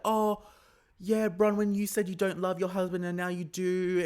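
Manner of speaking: high, loud, taunting mock yell; imitated yelling accusation. Recapper imitating a housewife attacking her rival's marriage, mock yelling. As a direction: throw it at her as a mocking accusation. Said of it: "she's yelling".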